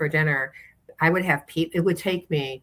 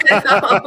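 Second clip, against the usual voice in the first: loud, high laughter, much louder; real laugh; 4 semitones higher, 9 dB louder than usual. Real, not acted. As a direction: burst out laughing at your own joke. Laughing after the accent bit.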